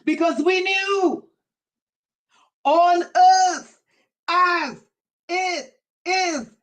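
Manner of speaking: fervent preacher pressing the congregation; preaching climax. Acted. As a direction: drive the point home. Preaching at full force.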